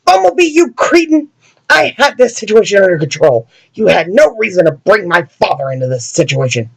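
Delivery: put-on high, indignant boy's voice starting very high; an arrogant kid hero chewing out a teammate; bratty yelling Robin. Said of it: "doing all this yelling".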